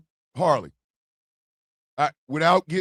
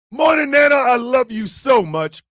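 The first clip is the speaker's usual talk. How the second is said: loud shout-out; 5 semitones higher, 6 dB louder than usual. Real, not acted. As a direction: shout love to grandma. Shout-out to Nana.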